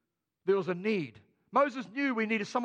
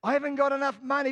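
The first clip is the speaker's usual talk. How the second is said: louder, fast, higher mock whine; a hint of a stingy excuse; 5 semitones higher, 6 dB louder, faster than usual. Congregants dodging tithing, louder mock excuse. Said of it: "some of you people".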